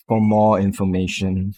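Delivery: ordinary talk, nothing marked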